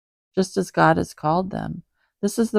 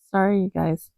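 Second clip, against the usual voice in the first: ordinary talk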